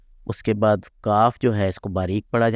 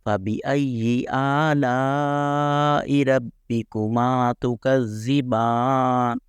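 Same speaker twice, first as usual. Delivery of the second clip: Quran recitation; 3 semitones higher, much slower than usual. Shown as a demonstration. Teacher quoting Surah Rahman, slow melodic recitation. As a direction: chant the verse slowly, tajweed style. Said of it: "جیسے وہ سورہ رحمان میں ہے".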